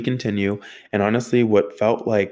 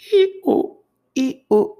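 very high, loud two-note squeak; squeaking wheel imitation; 6 dB louder than usual. Driver mimics the van creeping through the garage, squeaking wheels. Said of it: "like the wheels are squeaking".

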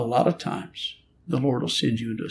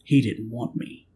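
ordinary talk, nothing marked

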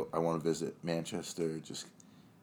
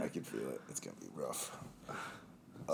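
strained and low from a cramp; getting a leg cramp; 6 semitones lower, 9 dB quieter, slower than usual. Real, not acted. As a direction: push words out through a cramp. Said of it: "Dude, I'm gonna cramp".

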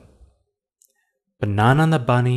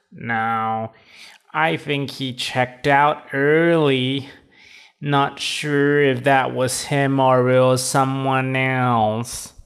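toad receptionist voice; 2 semitones higher than usual. Acted.

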